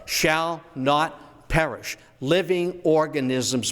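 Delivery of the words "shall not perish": slow and weighty like a commandment. Shown as a demonstration. Historian intoning the phrase slowly like a commandment. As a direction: pronounce it like law from the mountain. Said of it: "that's the Decalogue".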